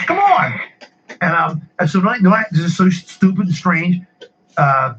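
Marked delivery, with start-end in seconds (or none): 0.1-0.6 very high-pitched mock yell; retold scream. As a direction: re-enact snapping at a cashier in exasperation. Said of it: "I screamed at her".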